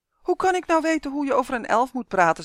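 high, loud wronged protest; aggrieved. A narrator's reading. Justin defends himself against the elf, aggrieved high protest. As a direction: protest as if unfairly blamed. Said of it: "zei Justin verongelijkt".

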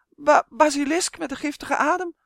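high, loud, trembling fairy; frightened stutter. A narrator's reading. Trembling fairy answering Tonia, stuttering. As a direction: stammer it out in terror. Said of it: "stotterde het trillende wezentje".

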